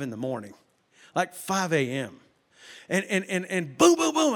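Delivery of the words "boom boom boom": high, loud booms in a burst; imitated banging. Telling of noise at five A M, loud mock banging. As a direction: mimic hard pounding on a door. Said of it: "I hear this sound".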